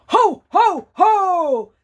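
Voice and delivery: jolly Santa ho ho ho, loud; Santa laugh. Shown as a demonstration. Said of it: "I'll do it with you".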